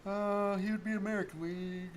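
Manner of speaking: talking through a yawn; words stretched by a yawn. Real, not acted. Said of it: "just yawning".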